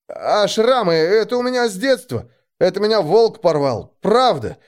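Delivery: high, pleading babble; whimpering excuses ending on a high plea; exaggerated fright. A narrator's reading. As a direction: beg anxiously, playing harmless. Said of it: "что испугался сильнее, чем на самом деле".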